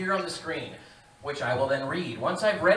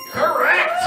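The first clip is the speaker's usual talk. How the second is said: excited correct; 11 semitones higher, 12 dB louder than usual. Acted. Host confirms answer.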